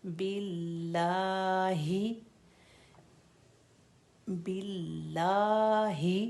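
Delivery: बिल्लाहि very slow with the हि sounded clear; slow clear demo. Shown as a demonstration. Teacher repeating for students: slow clear बिल्लाहि. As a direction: stretch बिल्लाहि, make हि heard. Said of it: "ये हा clear हो".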